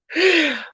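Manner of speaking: short high-pitched laugh. Real, not acted. Seller amused at talk of her accent, short laugh. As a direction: burst into a brief, high laugh.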